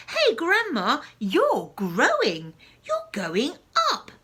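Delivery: George yells up at her, high and loud; alarmed boy shouting a warning. Acted. George shouting to his growing grandma. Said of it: "George gave a jump and shouted".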